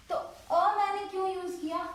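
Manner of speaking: ordinary talk, nothing marked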